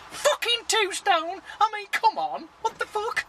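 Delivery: high, disbelieving excitement; excited. Real, not acted. Vlogger thrilled to tell viewers about losing two stone, high excited disbelief. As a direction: squeal it out in delighted disbelief.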